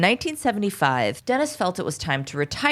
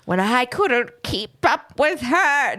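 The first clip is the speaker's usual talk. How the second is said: hammed-up ancient man, slow and higher; dying old man; 6 semitones higher, slower than usual. Podcaster dramatizing the suspect's confession for a friend, slow dying old man. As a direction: drag it out as if withering away. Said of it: "keeps getting older and older".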